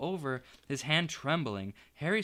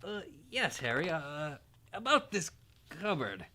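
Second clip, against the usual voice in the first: forced politeness; 3 semitones higher than usual. A narrator's reading. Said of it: "forced his face into a smile".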